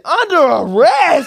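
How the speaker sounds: very loud, high, drawn-out disbelief; high-pitched incredulous shout; shocked outcry. Retold or imitated. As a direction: yell it back in mock outrage. Retold shock at arrest.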